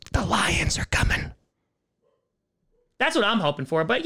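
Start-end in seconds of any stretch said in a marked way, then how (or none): 0.1-1.3 slow whispered threat; creepy slow whisper; ominous whisper. Acted. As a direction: breathe it like a warning.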